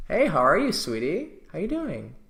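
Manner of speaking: high, swooping, effusive hello; greeting her friend. Shown as a demonstration. Greeting a girl's best friend, effusive and high. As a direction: greet her like a long-lost sweetie. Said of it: "her best friend".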